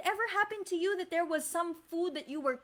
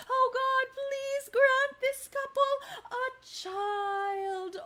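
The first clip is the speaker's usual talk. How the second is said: earnest prayer; 6 semitones higher, slower than usual. Shown as a demonstration. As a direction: cry out to God for a child. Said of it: "can you say it one more time".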